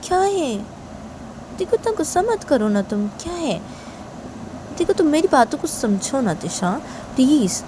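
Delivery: high, softened coaxing voice; acted tender plea; soft pleading. A narrator's reading. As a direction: gently appeal to an angry partner. Shanaya pleads with angry Tisha.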